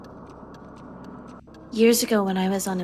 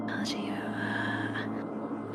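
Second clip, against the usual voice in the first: muttered under the breath while checking; whisper to self; 10 dB quieter, slower than usual. Real, not acted. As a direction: whisper it to yourself. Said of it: "whispering to self".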